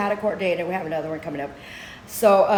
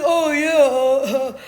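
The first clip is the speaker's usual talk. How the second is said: mock clueless man; 3 semitones higher, 3 dB louder than usual. Man fumbling an answer to the judge, mock clueless. Mocking Thomas's answer in court.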